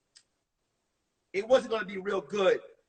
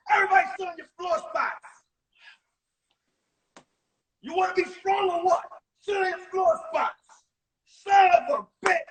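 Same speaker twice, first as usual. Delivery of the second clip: high, loud hammed-up drunk coach bark; drunk gym teacher yelling; 8 semitones higher, 4 dB louder than usual. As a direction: rage at the class like a boozy gym teacher. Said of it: "be like this".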